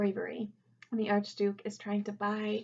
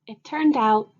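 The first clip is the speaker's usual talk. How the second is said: lively read-aloud for a class, louder than her chat; storybook reading; 8 dB louder than usual. A narrator's reading. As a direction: read it to the kids with bright expression. Teacher reads picture book aloud.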